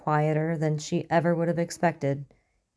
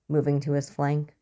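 ordinary delivery

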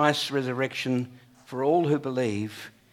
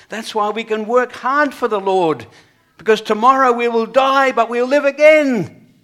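building to a loud, high ringing peak; climactic preaching; 9 semitones higher, 12 dB louder than usual. Acted. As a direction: lift the congregation to the final cry. Preacher's rising climax on hope.

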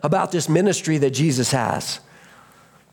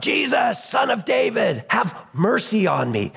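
high-pitched acted yell; 6 semitones higher than usual. Blind beggar to Jesus through the passing crowd, yelling out. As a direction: call out high for mercy, dropping at the end. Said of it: "he starts to yell out".

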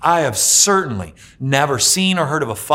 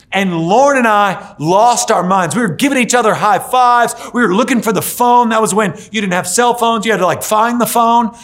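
higher and louder, excited rush; thrilled retelling; 7 semitones higher, 5 dB louder than usual. Retold or imitated. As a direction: relive the joy out loud. Retelling daughter's first steps.

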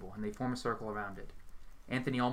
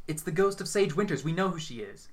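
higher, louder insisting; heated argument; 6 semitones higher, 6 dB louder than usual. A narrator's reading. Orlando argues with the medium, insisting loudly. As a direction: push back hard. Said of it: "Orlando argues".

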